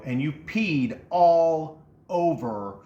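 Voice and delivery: very slow, drawn-out accusation; a brother drawing out the stereo accusation. Retold or imitated. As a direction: drag out each word of the charge. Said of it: "He said".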